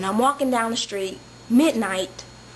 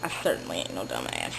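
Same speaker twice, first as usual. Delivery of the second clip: deep low punchline; 7 dB quieter than usual. Real, not acted.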